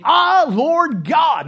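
awed exclamation. Acted. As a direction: stretch out the wonder. Reading Jeremiah's exclamation.

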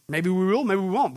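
ordinary delivery

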